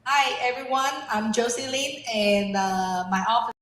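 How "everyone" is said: usual speaking voice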